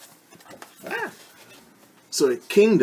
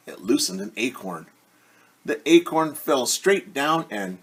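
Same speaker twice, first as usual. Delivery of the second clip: plain reading voice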